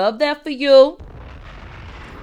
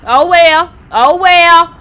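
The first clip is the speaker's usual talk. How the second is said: loud dismissive; 7 semitones higher, 11 dB louder, slower than usual. Real, not acted. Dismissive shout after tirade.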